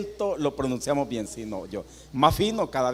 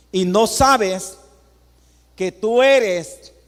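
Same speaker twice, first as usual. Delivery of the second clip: very slow, loud, higher solemn rebuke; God's solemn rebuke; 6 semitones higher, 6 dB louder, much slower than usual. Acted. Preacher voicing God's rebuke to the proud church, slow and loud. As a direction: draw each word out like a divine verdict. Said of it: "mire lo que dice Dios".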